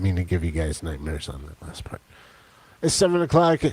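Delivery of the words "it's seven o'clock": high loud excited call; excited announcement. Real, not acted. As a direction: announce it with a burst.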